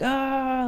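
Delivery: high sustained "ah"; mock hyping cheer. Guys on the shuttle hyping them up, high mock cheer. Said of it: "like, hyping us up".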